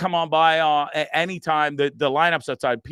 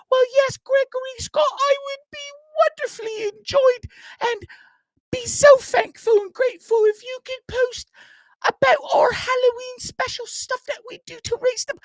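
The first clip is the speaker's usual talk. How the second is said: much higher, loud mock-mom voice; mom impression; 4 dB louder than usual. Son imitating mom asking him to post, much higher and louder. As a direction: ham up his mother nagging him. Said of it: "I do her voice like that".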